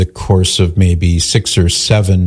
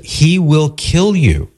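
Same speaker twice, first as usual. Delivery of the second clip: retold warning, drawn out and higher; insistent warning; 7 semitones higher, much slower than usual. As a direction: stress it slowly, in deadly earnest. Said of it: "he was so insistent".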